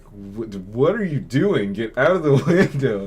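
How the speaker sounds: exasperated shout, laugh breaking in; alarmed scolding. Retold or imitated. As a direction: scold a friend in disbelief, voice climbing. Retold alarm at friend in window.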